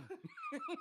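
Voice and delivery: high squeal laugh. Real, not acted. Group laughing.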